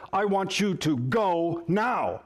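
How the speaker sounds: slow, with "go" and "now" each set apart; stress on go now. Shown as a demonstration. Spouse showing urgency by emphasis, "go now" stressed slowly. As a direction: land hard on "go" and "now". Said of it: "Or you also might hear".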